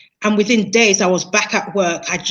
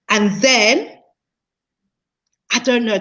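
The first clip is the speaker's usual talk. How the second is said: usual speaking voice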